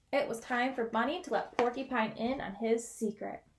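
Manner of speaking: ordinary delivery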